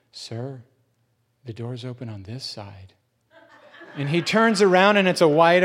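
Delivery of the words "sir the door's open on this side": much softer, matter-of-fact and low; calm kid. Retold or imitated. Teen calmly telling panicking man the door is open, soft. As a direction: quietly point out the obvious. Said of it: "the little kid goes".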